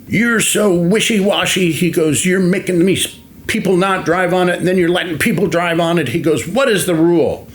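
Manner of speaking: high-pitched, heated retold tirade; livid boss. Livid Father confronting a worker over the gravel rule, heated and high-pitched. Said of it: "he was livid".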